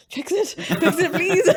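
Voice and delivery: high, loud, laughing plea; a giggly, pleading barbarian asking the healer to fix the chair; pleading character voice. Retold or imitated. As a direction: beg him to fix it, half laughing.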